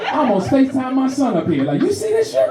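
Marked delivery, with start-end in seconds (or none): 1.8-2.5 high squeaky question; mock call to son. Comic faking a FaceTime to his son, high pitched.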